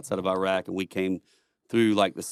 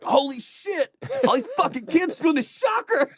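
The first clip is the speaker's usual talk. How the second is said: much higher, hammed-up disbelief; mock shocked soldier. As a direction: squeal it like a stunned army grunt.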